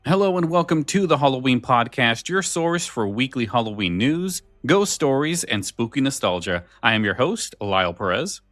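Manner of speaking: normal talking voice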